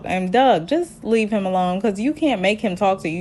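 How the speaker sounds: ordinary delivery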